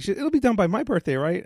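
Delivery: worked-up retelling of an angry reaction; getting angry. Retold or imitated. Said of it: "Then I started getting angry".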